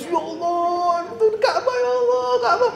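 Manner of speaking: very high, drawn-out awed wail; mock tearful cry. Pilgrims first sighting the minarets of Haram, imitated tearful cry.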